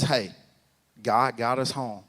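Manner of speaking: low, soft and slow; calm reassurance. Retold or imitated. Wife to her angry husband, calm reassurance. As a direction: settle him down with a quiet, unhurried word.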